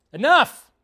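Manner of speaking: high, loud sudden shout; shouted command. Acted. Vlogger suddenly shouting a movie line, loud and high. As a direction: cut everything off sharply.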